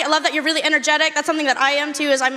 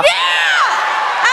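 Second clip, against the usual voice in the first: excited yell; 5 dB louder than usual. Real, not acted. Fan shouts back, hyped.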